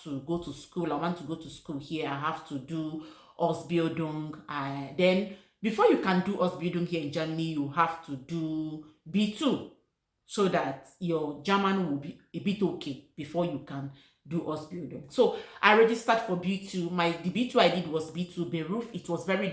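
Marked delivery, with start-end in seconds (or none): none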